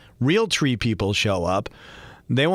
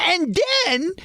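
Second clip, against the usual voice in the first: nephew's and then. Retold or imitated. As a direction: shout it like an excited kid storyteller. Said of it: "as my nephew likes to say".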